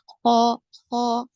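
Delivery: خا said thick and full from the throat; heavy kha. Shown as a demonstration. Teacher shows students the heavy خا sound. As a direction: give خا a heavy, rounded sound. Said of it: "خا کو اور الف کو دونوں کو موٹا پڑھیں گے".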